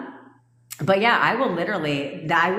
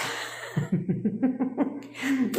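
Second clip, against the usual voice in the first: high, rippling laugh; real burst of amused laughter; 8 semitones higher, 5 dB quieter than usual. Real, not acted. Coach remembers a funny client call, amused laugh.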